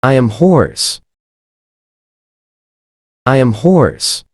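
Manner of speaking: ordinary talk, nothing marked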